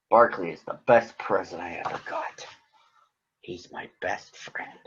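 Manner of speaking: soft, slowing tender whisper at the end. A narrator's reading. Big Bird telling Granny about his best friend, tender whisper. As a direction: hush it lovingly, like a secret.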